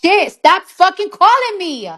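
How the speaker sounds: sharp, loud, fed-up yell; annoyed shout. Real, not acted. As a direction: snap at whoever keeps calling. Annoyed at phone ringing.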